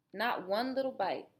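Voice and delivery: ordinary delivery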